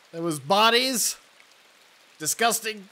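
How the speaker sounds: normal talking voice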